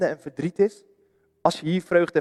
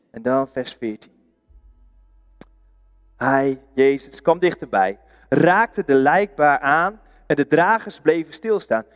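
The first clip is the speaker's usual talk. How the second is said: ordinary delivery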